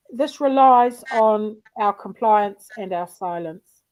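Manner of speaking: ordinary delivery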